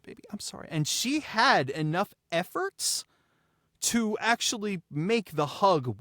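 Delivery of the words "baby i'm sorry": breathy whisper, no voice at all; whispered apology. Retold or imitated. Parent soothing a crying daughter, whispered. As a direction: hush it out like soothing a child.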